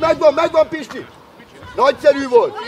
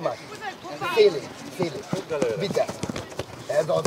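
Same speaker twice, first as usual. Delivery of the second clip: normal talking voice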